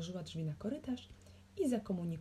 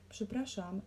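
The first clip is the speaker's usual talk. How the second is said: ordinary delivery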